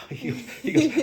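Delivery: breaking into laughter mid-story; laughing. Real, not acted. Cop retelling sergeant's words, laughing.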